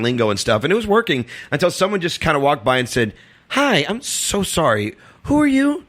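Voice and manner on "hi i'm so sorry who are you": high, slow, mock-sweet politeness; polite challenge. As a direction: mimic a gatekeeper politely questioning an intruder. Retold gatekeeper challenging a gatecrasher.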